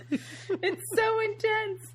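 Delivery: It's so intense, drawn out high and slow; high, slow mock-dramatic. Shown as a demonstration. Podcast host echoing herself, high and slow. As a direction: stretch it up high like a melodrama.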